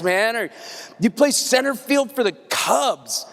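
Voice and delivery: usual speaking voice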